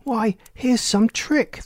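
high and slow, suspicious; a lady cries out, suspecting a trick; lady's cry. A narrator's reading. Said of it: "the lady cried".

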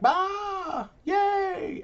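high squealing yay of the crowd; mock cheer. Imitating everybody cheering at the Pope's announcement, high squeal. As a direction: cheer like the whole crowd at once.

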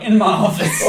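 a hint of a stern principal, laughing; mock principal summons. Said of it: "The principal was like".

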